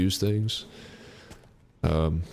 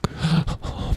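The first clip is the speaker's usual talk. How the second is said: startled gasp; 10 semitones higher than usual. Real, not acted. Startled by enemy nearby.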